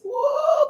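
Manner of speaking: high shouted woo; ecstatic whoop. Real, not acted. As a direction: let out a joyful preacher's whoop.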